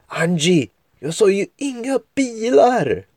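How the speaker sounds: put-on high, startled boy's voice calling out; a scared friend scolding; worried boy. Denji scolds Angie after the jump.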